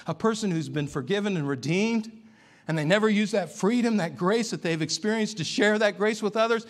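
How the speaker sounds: loud, high, building sermon climax; preaching at full force. Acted.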